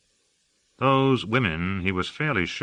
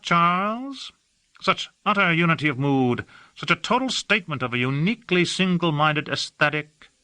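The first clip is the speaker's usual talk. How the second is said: higher, airy, languid society gentleman; affected aristocrat; 9 semitones higher than usual. A narrator's reading. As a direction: gush about the city like a bored aesthete. Hawke chatting by the pool.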